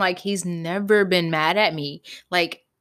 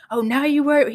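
higher, louder mock indignation; imitating mad boyfriend; 7 semitones higher, 5 dB louder than usual. Boyfriend answering her worried call, mad and sarcastic. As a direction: throw it back accusingly, imitating him. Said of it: "He was mad as fuck".

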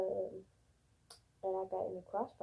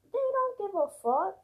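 higher and louder, They don't give a fuck; angry outburst; 9 semitones higher, 6 dB louder than usual. Real, not acted. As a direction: blurt it in real anger. Ranting about government.

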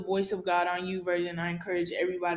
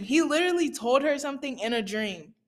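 high-pitched, astonished outburst; real amazement, pitch jumping up; amazed disbelief; 6 semitones higher, 4 dB louder than usual. Real, not acted. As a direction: exclaim it like you can't believe it. Amazed at friend's dream revelation.

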